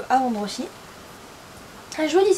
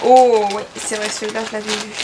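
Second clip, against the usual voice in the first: excited; 2 semitones lower, 7 dB louder than usual. Real, not acted. Recognises a model, delighted.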